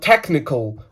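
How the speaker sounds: TECHNICAL in a fluent English accent; authentic English accent. Shown as a demonstration. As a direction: say "technical" like a native English speaker. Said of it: "το λένε τέλος... Ας πούμε, ο άλλος λέει το".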